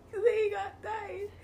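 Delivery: very slow, broken up by giggles; laughing. Real, not acted. Cracking up while explaining the goat to her friend. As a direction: barely get the words out for laughing.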